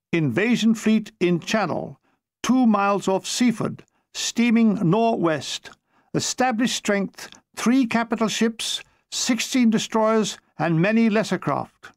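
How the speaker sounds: slow, measured signal dictation; reading military message. Acted. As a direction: read out an urgent signal, phrase by phrase.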